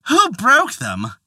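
high, stubborn, angry reply; angry wife. Acted. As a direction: fling it back at him in anger. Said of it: "said the wife in anger".

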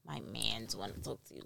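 low, quiet imitation of a messenger; mock go-between. Woman imitating a man sent to chat her up, mock go-between. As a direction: drop low and soft, mimicking his approach.

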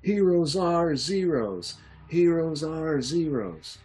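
high, loud, slow protest chant; protesters shouting. Acted. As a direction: chant it like an angry crowd. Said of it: "shouting things like".

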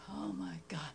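unvoiced exasperated whisper; whispered exasperation. Mom whispering impatiently while son showers. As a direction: breathe it under your breath, fed up. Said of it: "I was just like".

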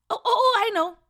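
high, loud burst of eagerness; sudden excited idea. Acted. Brother struck by an idea to help, excited.

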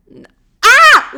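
sudden high, loud squeal of disgust; disgusted shriek. Real, not acted. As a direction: yelp as if burned by the words. Recoiling at own cheesy words.

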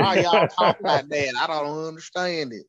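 exaggerated Southern twang, stretched out; mock country accent. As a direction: ham up a puzzled country accent. Mocking the teased country talk.

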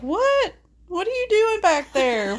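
surprised calling out. Pretend. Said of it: "I would be the one that'd be like".

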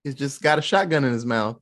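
ordinary talk, nothing marked

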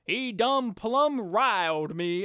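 slow, deep put-on cowboy drawl; fake cowboy accent. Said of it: "really deep fake cowboy voice".

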